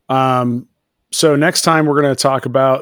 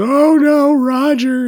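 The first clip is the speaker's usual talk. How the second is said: high, mock wail; Christine crying; 13 semitones higher, 3 dB louder, much slower than usual. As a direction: wail it out like a melodramatic heroine. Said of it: "Christine starts crying about it".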